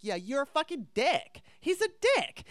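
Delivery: mock indignant comeback; scornful retort. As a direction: sneer at him. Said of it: "It's like".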